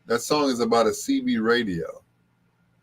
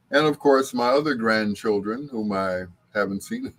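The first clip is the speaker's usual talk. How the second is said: normal talking voice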